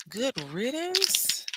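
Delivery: "Good riddance?" rising high, slow and doubtful; slow incredulous question "Good riddance?"; doubtfully asking back. Shown as a demonstration. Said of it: "questioning the phrase".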